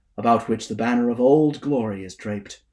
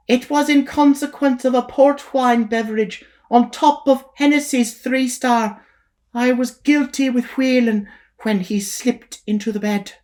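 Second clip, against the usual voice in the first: high, confessing woman's voice; 13 semitones higher than usual. Acted. Kitty confesses her fall to the preacher. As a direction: own up to sins with mock shame.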